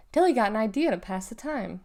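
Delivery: ordinary delivery, nothing marked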